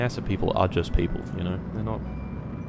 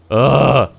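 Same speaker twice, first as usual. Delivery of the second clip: long, loud ugh; drawn-out groan of frustration; exasperated groan; 3 semitones higher, 9 dB louder than usual. Real, not acted. Gamer giving up on the mission, exasperated groan.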